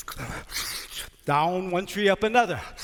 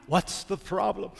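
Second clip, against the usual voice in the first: ordinary delivery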